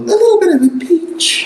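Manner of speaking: very high, squeaky princess voice; Peach voice. Acted. Co-host demands the actress do Peach, very high princess voice. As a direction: put on a high-pitched Peach. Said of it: "she better do, like, a little".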